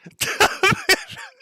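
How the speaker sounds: real screaming laugh; shrieking laugh. Real, not acted. Reactor laughs hard.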